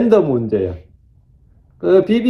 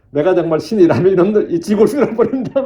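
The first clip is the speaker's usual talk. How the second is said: mock threat dissolving into laughter; laughing retold threat; 2 semitones higher than usual. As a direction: quote the angry comment, cracking up. Quotes angry online comment, laughing. (웃으며 흉내 내는 으름장)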